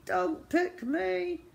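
very high, slow little plea; desperate begging. A narrator's reading. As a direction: squeak out a drawn-out plea. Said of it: "he begged silently".

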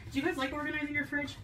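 ordinary talk

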